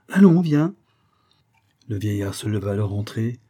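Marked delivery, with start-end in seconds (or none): 0.1-0.7 brisk high urging, louder; lifted, forceful come-along call. A narrator's reading. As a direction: urge her along eagerly. Husband urging his wife inside.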